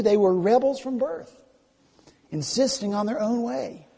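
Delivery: ordinary talk, nothing marked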